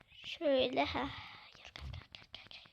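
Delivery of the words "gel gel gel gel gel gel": breathy whisper, urging under the breath; whispered coaxing. Real, not acted. Gamer willing his character over the gap, in a whisper. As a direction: whisper it like you're willing it to come.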